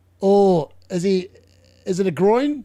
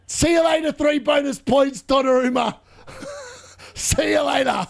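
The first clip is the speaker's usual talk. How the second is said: higher-pitched, gleeful taunt; gloating send-off; 6 semitones higher than usual. Real, not acted. United fan waves off Donnarumma after the goal, gloating. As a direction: crow it at the beaten keeper.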